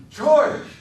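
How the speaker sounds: high, loud joyful cry of his name; a big child seeing his friend return; calling George. A narrator's reading. Lenny calling out to George, high and loud.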